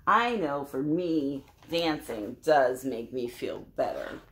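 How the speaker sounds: ordinary delivery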